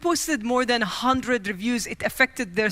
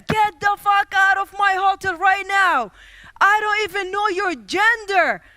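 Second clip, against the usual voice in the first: furious shout; 7 semitones higher, 4 dB louder than usual. Retold or imitated. Hotel man yelling at her.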